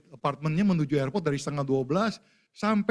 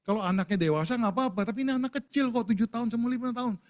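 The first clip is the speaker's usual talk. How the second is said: put-on pitched-up outrage over the children; rising disbelief, high and urgent; indignant protest; 7 semitones higher, faster than usual. Father protests airline separating mother and children, pitched high in outrage. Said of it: "saya bilang".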